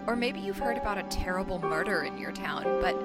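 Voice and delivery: hushed, ominous podcast opener; true crime narrator. Acted. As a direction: draw listeners in with quiet dread. Podcast cold open over music.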